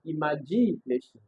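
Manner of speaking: "imagination" with GI punched; stress on third syllable. Shown as a demonstration. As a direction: lean hard on the middle syllable GI in "imagination". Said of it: "And then C, I'll say".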